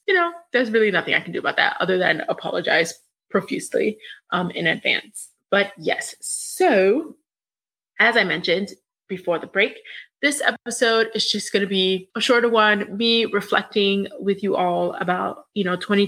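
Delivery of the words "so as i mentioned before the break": usual speaking voice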